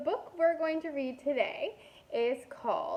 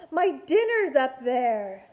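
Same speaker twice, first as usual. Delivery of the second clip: worried; 7 dB louder than usual. Acted. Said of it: "thought Marcel".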